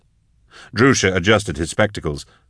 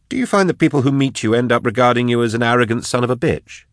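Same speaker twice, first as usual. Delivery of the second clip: higher-pitched irritated snap; a touch of anger, pitched up; snapped; 6 semitones higher, faster than usual. A narrator's reading. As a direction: snap the question, mildly exasperated. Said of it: "snapped Drusha".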